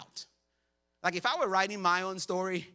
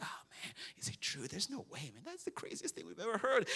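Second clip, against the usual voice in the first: hushed, much softer scared whisper; 9 dB quieter than usual. Retold or imitated. Scared disciples hiding in a bunker, hushed whisper. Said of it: "whispering to each other like".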